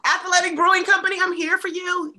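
high, loud and quick, calling out to the brand; playful sponsor pitch. Acted. Hoping for a sponsorship, she calls out high and eager to the beer brand. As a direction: pitch yourself eagerly to Athletic Brewing Company.

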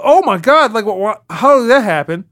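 high-pitched, stammering amazement; mock astonished fans. Podcaster imitating people reacting to the trailer, mock astonishment. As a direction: gasp it out like a baffled audience. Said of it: "So people go".

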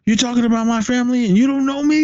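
who are you to talk about my family; retold outrage, voice shooting up; outraged disbelief. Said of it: "Sidney's like".

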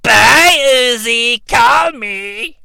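a light, high, flirty witch queen; Rita Repulsa voice. Retold or imitated. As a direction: coo it like a vampy villainess. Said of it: "That's by Rita Repulsa".

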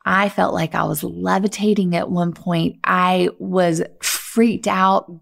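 ordinary delivery

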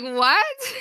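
loud, high startled "What?"; shocked what. Retold or imitated. Telling a friend how she reacted when he asked to kiss, loud shocked. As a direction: blurt it out in shock.

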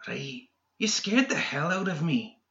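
high-pitched shaken villain; rattled villain voice. A narrator's reading. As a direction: play him jumpy and sour. McMorning voiced higher.

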